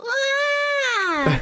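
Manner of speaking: tiny high-pitched cry like Chikawa; high squeak. Retold or imitated. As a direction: squeak like a little creature pushed over. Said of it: "he would probably go".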